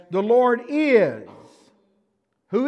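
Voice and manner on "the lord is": "The Lord is" slow and loud, landing on is; stress on is. Shown as a demonstration. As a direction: hit the word is, slow and loud. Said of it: "the insertion says".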